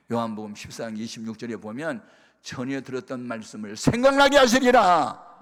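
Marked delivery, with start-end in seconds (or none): 2.4-5.4 booming, much louder scripture quote; scripture proclaimed. Real, not acted. Preacher booming a verse to the congregation, full force. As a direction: proclaim it to the whole church.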